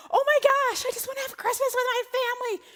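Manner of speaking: high, loud, at the end of her rope; desperate outburst. Retold or imitated. Mother told to fly through Texas, desperate loud cry. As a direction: cry out that you just want Christmas. Desperate after bad rerouting.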